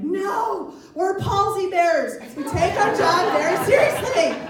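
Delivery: high, drawn-out indignant refusal; offended, high-pitched proud protest; indignant pallbearers. Acted. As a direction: protest loftily, stretching the words out high. Acts indignant pallbearers refusing help.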